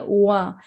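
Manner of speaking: long वा as "waa" with no teeth; rounded-lip W. Shown as a demonstration. Teacher repeating the correct reading, rounded "waa". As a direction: glide from rounded lips into वा. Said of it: "होठों से touch ना हो".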